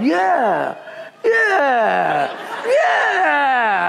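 high, drawn-out mock crowd cheer; mob yelling. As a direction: imitate a riled-up crowd egging each other on. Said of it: "They're all yelling".

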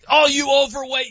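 loud, high mock heel taunting the crowd; wrestling heel taunt. Host imitating a wrestling heel, loud and high mock taunt. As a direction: play a pro wrestling heel baiting the fans. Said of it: "the guy comes out and he's like".

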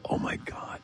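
hushed, whispered imitation of dismay; mortified whisper. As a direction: whisper it under your breath, embarrassed. Retelling own embarrassed reaction.

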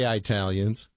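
Italians said as eye-TAL-yuns; colloquial mispronunciation. Shown as a demonstration. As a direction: open Italians with a long EYE. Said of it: "some people say".

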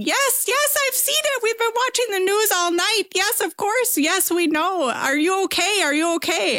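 very high-pitched frantic mom voice; imitated screaming mom. Mom answering her kid's tornado call, imitated high screaming. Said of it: "she just starts screaming".

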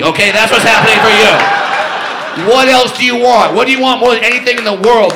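loud, fast furious mock Santa; angry Santa. Elf yells gift offers at the boy to spite dad, loud. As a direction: bark the gifts out angrily.

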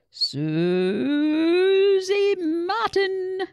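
loud, high announcement voice; cheerful headmaster on the PA; cheery headmaster. A narrator's reading. Headmaster announces the art winner, loud and cheery. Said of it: "headmaster's cheery voice over the school's P A system".

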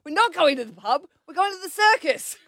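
a hint of a flustered mum; firm mum correction. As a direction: set the little one straight, mock stern. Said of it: "I said".

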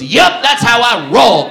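slow, loud proud boast; cocky guy. Retold or imitated. Wheelchair guy boasting to the comic, slow loud cocky. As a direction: deliver it like a smug punchline. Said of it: "He looks at me and goes".

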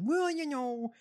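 high, drawn-up mimicry, a little soft; mocking politician voice. Co-host retells her debate answer, high mocking voice. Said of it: "and she said".

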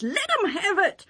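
high-pitched urging on; rousing battle cry. Retold or imitated. Young master urging herdsmen into the fight, rousing.

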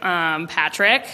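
low, slow, obvious answer; knowing deadpan. Retold or imitated. Mom raising her eyes at daughter, knowing.

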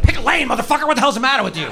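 high furious yell at another driver; road rage yell. Retold or imitated. Comic at a bad driver, a high furious yell. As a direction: scream it at the car in the next lane. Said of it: "Especially when I'm driving".

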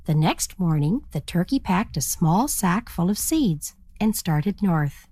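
ordinary delivery

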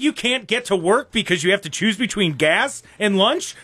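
dripping with fake enthusiasm; sarcastic. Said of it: "That's sarcasm".

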